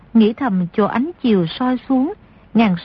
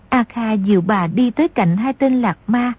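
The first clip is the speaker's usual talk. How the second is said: ordinary delivery, nothing marked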